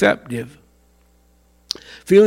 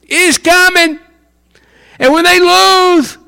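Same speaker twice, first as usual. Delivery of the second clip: normal talking voice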